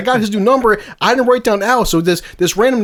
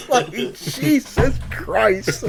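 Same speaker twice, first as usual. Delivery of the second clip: laughing slowly; 4 semitones higher, 4 dB quieter, much slower than usual. Real, not acted. Laughing at the picture.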